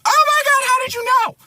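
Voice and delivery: loud, very high mock shriek; shocked sister. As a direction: squeal in horror at being found out. Sister shocked at being found out.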